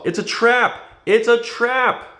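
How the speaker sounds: high, loud urgent warning. A narrator's reading. Leia warns Luke of the trap, loud and high. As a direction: yell it to stop him. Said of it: "Leia called".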